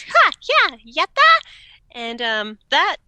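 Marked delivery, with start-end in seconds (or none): 0.0-1.4 short, high fighting-game shouts; punchy little arcade fighter yells; imitated game grunts. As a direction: mimic video game battle cries. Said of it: "all that was, was".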